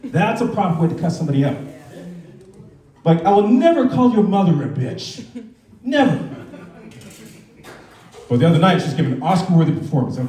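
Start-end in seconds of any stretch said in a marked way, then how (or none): 3.0-6.2 laughing. Real, not acted. Joke punch with laughter.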